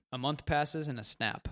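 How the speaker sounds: ordinary delivery, nothing marked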